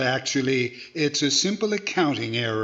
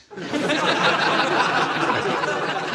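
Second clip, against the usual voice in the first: hearty laugh; 11 semitones higher than usual. Acted. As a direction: let the laugh burst out high and full. Edgar laughs at Charlie's reply.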